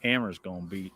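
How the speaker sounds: put-on low, soft Texas accent. Quoting a West Ham fan he met, in a Texas accent. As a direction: quote him in a low Texas twang. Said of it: "I said that in a Texas accent".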